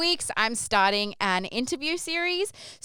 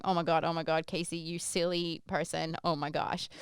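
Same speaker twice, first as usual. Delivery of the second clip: quiet self-scolding; 3 semitones lower, 8 dB quieter than usual. Retold or imitated. Scolding herself at the back.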